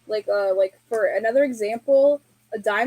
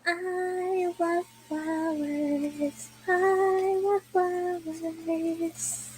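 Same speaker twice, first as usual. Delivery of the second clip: high, very slow, softer character voice; Fufa; 6 semitones higher, 4 dB quieter, much slower than usual. Acted. Another streamer trying the impression, soft high Fufa. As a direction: stretch each word softly, up high. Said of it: "I would Fufa".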